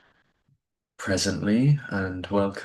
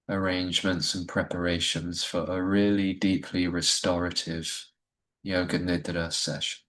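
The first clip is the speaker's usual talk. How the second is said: guided meditation. Acted. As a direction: ease listeners into stillness with gentle pacing. Leads a Yoga Nidra session.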